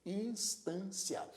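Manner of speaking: instância slowly in three syllables. Shown as a demonstration. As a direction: sound out instância, cia kept whole. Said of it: "apresenta três sílabas".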